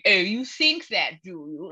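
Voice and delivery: mock posh accent, drawn-out words; imitating a super posh person. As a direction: put on a posh accent to challenge someone. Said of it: "someone super posh going".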